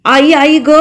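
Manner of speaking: put-on girl's loud pained cry. A narrator's reading. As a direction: cry out in sudden pain. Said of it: "ती जोरात ओरडली".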